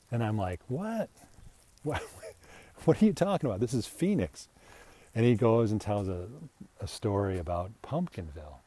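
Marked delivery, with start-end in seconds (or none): 0.7-4.5 high-pitched retold disbelief; mock incredulous. Reacting to colleague's Pumpkinville story, mock incredulous. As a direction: blurt it out as if baffled.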